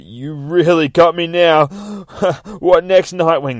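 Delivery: high, loud mocking glee; laughing taunt. Judge held by the collar taunting Nightwing, laughing. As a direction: tease through big laughter. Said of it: "He's laughing his head off".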